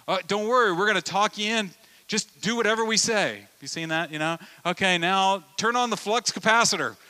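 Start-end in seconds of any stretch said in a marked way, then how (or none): 0.1-3.4 higher, louder, reassuring controller; calm tower voice. Retold or imitated. Controllers reassure panicked pilot, higher and louder. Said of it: "And they're saying". 4.7-7.0 higher, louder instructing voice; mock controller. Controller instructs pilot, mock radio voice, higher.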